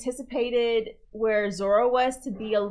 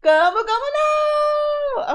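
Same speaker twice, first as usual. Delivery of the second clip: anime attack yell; 7 dB louder, slower than usual. Retold or imitated. Echoing the anime attack call.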